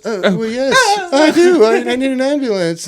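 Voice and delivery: high, put-on British-Australian voice catching on; British-Australian roommate. Roommate taking the police hint, high British-Australian voice. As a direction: squeak agreement in a muddled British-Australian accent. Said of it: "British and Australian".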